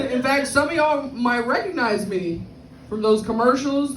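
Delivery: ordinary talk, nothing marked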